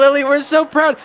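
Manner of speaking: high, loud congratulating cry; proud villager. Acted. Villagers crowding round their new songstress, proud and excited. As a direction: gush with pride over the crowd.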